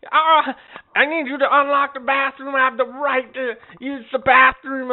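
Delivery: put-on high-pitched old woman insisting on her rights; mocking, high old-lady voice; demanding old lady. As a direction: imitate an indignant customer making demands. Imitated old lady demanding bathroom.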